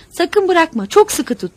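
high, loud urgent order; tense, forceful yell; shouting. A narrator's reading. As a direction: shout a warning mid-emergency. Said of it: "diye bağırdı kadın".

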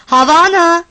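loud, high, drawn-out call; shout upstairs. A narrator's reading. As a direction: holler up to someone upstairs. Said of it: "diye bağırdı yukarıya".

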